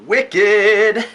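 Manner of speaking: high, loud hype shout; big hyped-up intro yell; excited. Acted. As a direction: punch it out like a show opener. Energetic intro exclamation.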